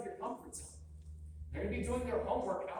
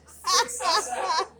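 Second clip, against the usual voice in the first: ordinary talk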